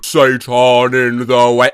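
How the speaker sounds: low demonic chant; Satan ringtone voice. Acted. Summoning friends hear the mum ringtone, low demonic voice. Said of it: "your mum calls you".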